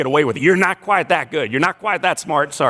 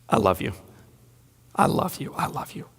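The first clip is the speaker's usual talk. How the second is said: softer each time until the last is only whispered; fading I love you; 4 semitones lower, 7 dB quieter than usual. Retold or imitated. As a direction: let it fade away to a breath. Father repeating love at bedside.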